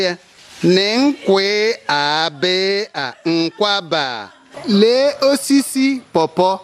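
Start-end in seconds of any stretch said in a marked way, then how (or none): 0.6-4.3 very slow model line. Shown as a demonstration. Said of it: "teacher models Igbo sentence".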